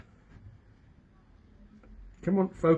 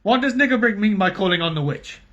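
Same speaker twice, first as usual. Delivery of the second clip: urgent doctor; 5 semitones higher, 5 dB louder than usual. A narrator's reading.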